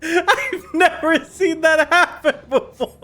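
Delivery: talking through real laughter; laughing. Real, not acted. Friend cracking up at the accidental death, laughing. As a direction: get it out between laughs.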